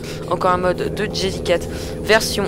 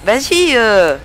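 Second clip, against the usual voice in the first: impatience exaspérée; 6 dB louder, slower than usual. Real, not acted. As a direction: impatiently push the driver to move, drawn out at the end. Presse un conducteur qui le bloque. (exaspéré, plus fort et plus aigu)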